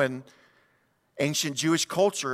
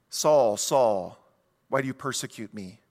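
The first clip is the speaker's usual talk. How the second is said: lower, grave voice; voice of Jesus; 6 semitones lower than usual. Acted. Jesus calling Saul, low and grave. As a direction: speak as a solemn divine voice. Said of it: "Jesus calls somebody by their first name twice".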